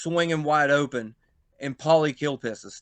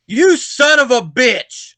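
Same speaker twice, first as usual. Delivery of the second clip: loud, high, slow outraged shout; a wrestler roaring in shocked betrayal; furious yell; 10 semitones higher, 12 dB louder, slower than usual. Retold or imitated. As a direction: bellow it at the betrayer. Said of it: "Marcus Twist just goes".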